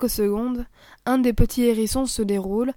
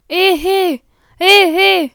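loud, high hollering; calling out; 8 semitones higher, 10 dB louder, much slower than usual. Acted. Gamekeeper hollering after escaping hedgehogs, loud. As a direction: holler to stop them.

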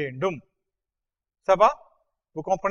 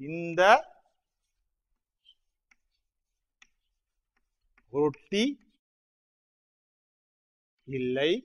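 word by word; much faster than usual. Shown as a demonstration. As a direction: give each word alone with long pauses.

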